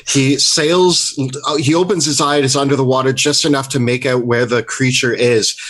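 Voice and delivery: ordinary delivery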